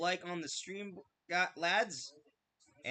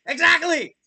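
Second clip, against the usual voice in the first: loud triumphant exclamation; excited shout; 10 semitones higher, 16 dB louder than usual. Real, not acted. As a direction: cheer that he finally got it. Friend finally gets it.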